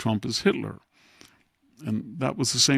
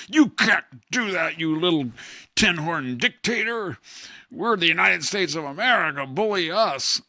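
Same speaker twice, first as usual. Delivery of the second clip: 1 mock bully; 6 semitones higher than usual. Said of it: "Democrats act like imperialists".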